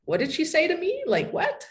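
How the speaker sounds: high, puzzled questions; imitating confusion. Local hearing her Spanish accent, high and puzzled. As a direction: play a baffled listener. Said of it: "what they're thinking is".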